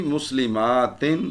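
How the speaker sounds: مسلماتن as "muslimaaatin", long aa and final "tin"; rising, stretched مسلماتن ending in "tin"; aatin case ending. Shown as a demonstration. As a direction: slowly hold "maa", then "tin" in مسلماتن. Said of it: "حالت نصب اور جر کیا ہوگی؟".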